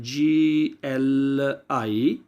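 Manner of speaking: GLI spelled out very slowly letter by letter. Shown as a demonstration. Teacher to students: spelling the letter group slowly. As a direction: draw out each letter of GLI. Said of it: "तीन लफ्ज़ मिला दिए जाएं".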